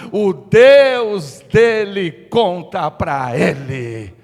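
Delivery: slow, loud, building warning; officer warning the king about Eliseu. Acted. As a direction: lean hard on each word like a warning. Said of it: "um dos chefes dele falou assim".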